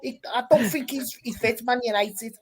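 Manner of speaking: put-on Scouse accent. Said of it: "Amazing Scouse accents".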